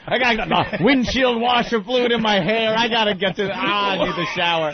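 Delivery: hammed-up disgust at being soaked; mock frantic complaining. Acting out covered in fluids.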